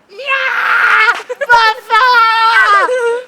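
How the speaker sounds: high, loud shrieking cry; imitating mom's shrieking scream. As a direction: scream it out in outrage. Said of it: "Og så skriger hun bare".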